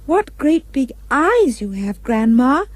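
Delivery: a curious child, lightly voiced; little girl gently wondering; innocent little girl. Girl speaking to wolf.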